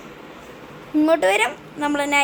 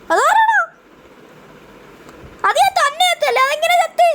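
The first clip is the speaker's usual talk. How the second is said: much higher, altered squeaky voice; changed voice; 12 semitones higher, 5 dB louder than usual. Real, not acted. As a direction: sound like a voice changer kicked in. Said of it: "എൻ്റെ സൗണ്ടൊക്കെ മാറിയിരിക്കുക". (ശബ്ദം മാറിയ ഉയർന്ന വിചിത്ര സ്വരം)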